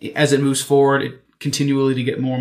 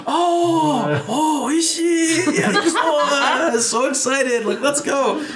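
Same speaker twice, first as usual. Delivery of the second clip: very high, slow, squealing delight; excited Japanese friends; 12 semitones higher, much slower than usual. Retold or imitated. Imitating Japanese friends who finally understood, very high squealing joy. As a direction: gush with sudden joy at recognizing the word. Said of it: "And they were all".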